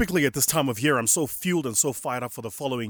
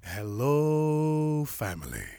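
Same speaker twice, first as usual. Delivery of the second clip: drawn-out greeting; 3 semitones higher, much slower than usual. Real, not acted. Let's try that again.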